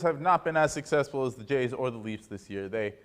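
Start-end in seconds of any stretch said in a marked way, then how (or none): none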